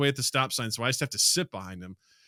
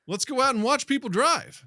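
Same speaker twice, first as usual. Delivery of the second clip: mock wife voice; 13 semitones higher, 4 dB louder than usual. Teasing couple's pastime.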